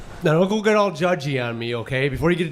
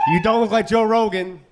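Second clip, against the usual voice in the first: wife's put-down; 6 semitones higher than usual. Retold or imitated. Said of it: "And she said".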